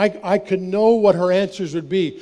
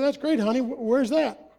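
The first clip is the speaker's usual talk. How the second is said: sweet clueless dad; 5 semitones higher, 4 dB quieter than usual. Retold or imitated. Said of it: "I said".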